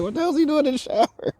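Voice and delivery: very high, fast, incredulous squeal; mock bewilderment. Hotel staff finding guest showering, high hammed-up disbelief. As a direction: imitate baffled staff, voice shooting way up. Staff's shocked reaction, retold laughing.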